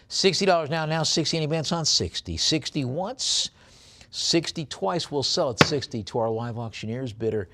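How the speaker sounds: quick, punchy bid calling, pitch jumping up on once and twice; auctioneer's call. Acted. Auctioneer calling bids to the online bidders, brisk and rhythmic. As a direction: rattle off the bids like a live auctioneer. Calls bids on coin lot.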